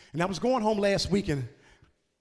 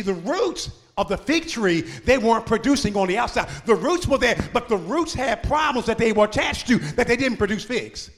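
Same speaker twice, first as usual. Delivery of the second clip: louder, ringing preacher cadence; preaching at full force; 4 semitones higher, 6 dB louder than usual. Acted. Pastor to congregation about bitter roots, full-force preaching. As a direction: lift the congregation with a rising sermon call.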